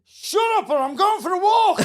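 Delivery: high, loud mock outburst; put-on anger. Phone-in host threatening his callers, playacted angry shout. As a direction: play up the rage, rising in volume. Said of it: "here's the classic one".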